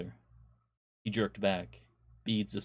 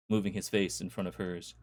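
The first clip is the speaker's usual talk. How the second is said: ordinary delivery, nothing marked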